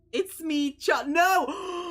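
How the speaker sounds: high, loud cry of dismay; sudden shouted no; alarmed shout. Real, not acted. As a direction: yell out in protest. Reader reacts in alarm.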